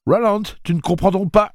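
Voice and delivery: voix très haute et forte, désespérée; supplication. Acted. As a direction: implore. Eddie supplie Roland. (Eddie supplie Roland, désespéré)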